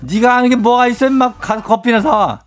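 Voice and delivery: angry boss. Acted. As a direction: chew out a junior employee. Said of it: "그렇게 저한테 화내셨잖아요".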